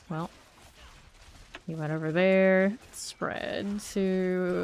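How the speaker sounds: normal talking voice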